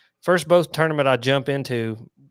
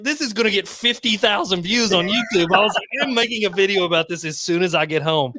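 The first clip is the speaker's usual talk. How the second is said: excited; 6 semitones higher than usual. Retold or imitated.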